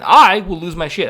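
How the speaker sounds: mock rage. Imagining his own rage.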